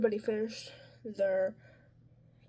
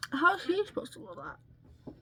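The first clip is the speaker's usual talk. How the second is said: mock teacher; 9 semitones higher than usual. Mimicking teacher's complaint.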